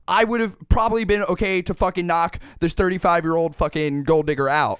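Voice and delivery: ordinary delivery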